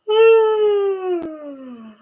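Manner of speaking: long, high sigh falling away; loud sigh. Shown as a demonstration. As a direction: let out a big releasing sigh. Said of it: "And a sigh or a yawn out".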